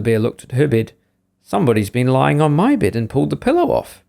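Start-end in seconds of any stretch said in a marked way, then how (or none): none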